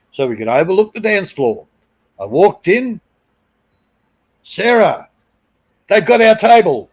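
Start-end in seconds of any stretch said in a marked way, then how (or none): 4.4-6.9 high, loud outraged call to his friend; indignant call. Retold or imitated. To Sarah, on finding their table taken, loud indignant cry. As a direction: storm in calling out the news.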